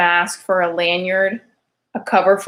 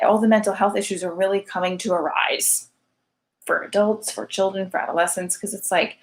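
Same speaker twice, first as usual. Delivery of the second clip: ordinary talk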